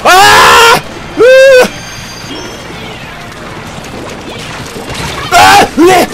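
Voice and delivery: loud high anguished yell; hit hard crying out. Real, not acted. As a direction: cry out as if struck hard. Cries out after being hit.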